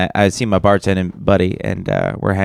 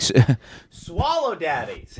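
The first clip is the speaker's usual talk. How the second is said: laughing mock yell; 8 semitones higher, 6 dB quieter, much slower than usual. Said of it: "Can you yell it to me?".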